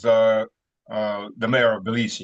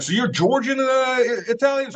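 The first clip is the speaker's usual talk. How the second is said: high, lightly voiced puzzled journalist; a hint of teasing disbelief; playfully quizzing tone; mock question; 11 semitones higher than usual. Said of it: "He's like".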